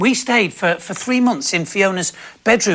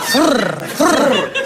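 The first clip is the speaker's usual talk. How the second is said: repeated trilled whirr, higher; toilet roll rattle; 4 semitones higher, 3 dB louder than usual. Retold or imitated. Husband imitating bathroom noise, two raised trills. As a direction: imitate paper reeling off the roll. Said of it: "It's the noise you can hear".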